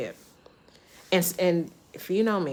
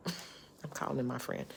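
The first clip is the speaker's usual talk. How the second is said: hushed aside; 5 semitones lower, 11 dB quieter than usual. Real, not acted.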